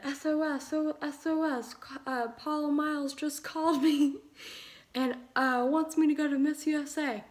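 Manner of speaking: high, loud, breathless rush of news; frantic excitement. Retold or imitated. Texting parents the huge news, frantic and loud. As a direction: blurt it out in a panic of excitement.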